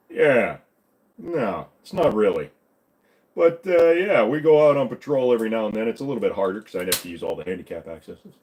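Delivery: cartoon policeman impression from the back of the throat; pushed throaty cop voice; Joe. Shown as a demonstration. Officer talking about going on patrol, throaty impression. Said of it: "do a Joe impression".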